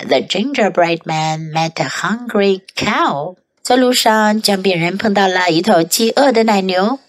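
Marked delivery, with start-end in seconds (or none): none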